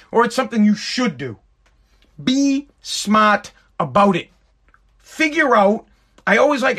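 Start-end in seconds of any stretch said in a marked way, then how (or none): 2.2-4.3 slow, every word weighed in "Be smart about it"; slow emphatic repeat. Real, not acted. Telling viewers to hold back on the loophole, slow and emphatic. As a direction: drag out "Be smart about it" so it sinks in.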